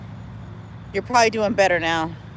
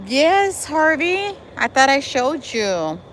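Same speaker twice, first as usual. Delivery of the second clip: high, bright call of a viewer's name; light up as you answer the chat; excited; 6 semitones higher, slower than usual. Real, not acted. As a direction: pitch jumps way up greeting Harvey.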